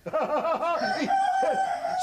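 bursting into a joyful laugh; delighted laugh. Real, not acted. Grandpa delighted at hearing again.

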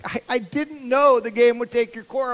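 a hint of a flustered big sister; defensive sister. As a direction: protest your innocence. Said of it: "She's like".

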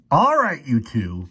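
high, loud opening bark. A narrator's reading. Professor breaking up a fight, barking. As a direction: bark to get their attention. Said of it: "barked the professor".

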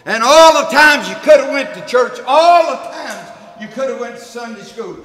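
loud, rhythmic sermon cadence with each phrase hammered; full-force preaching. Acted. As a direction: preach it, hit every line harder. Preaching on the rich man.

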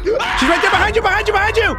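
laughing warning shout. Real, not acted. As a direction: warn them mid-laugh.

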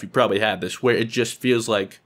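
ordinary talk, nothing marked

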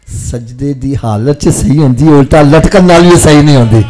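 sermon voice swelling to a roar; forceful preaching. Real, not acted. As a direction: boom out the point to the congregation. Preaching climax of anecdote.

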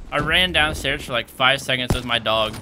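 ordinary talk, nothing marked

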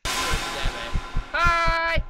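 high shouted hi; loud greeting. Real, not acted. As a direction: yell it out. Shouts at monster.

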